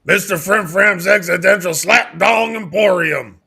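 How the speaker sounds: high, loud sponsor plug; shouted live read. Pretend. Host reads the sponsor on cue, shouted live read. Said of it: "Live read".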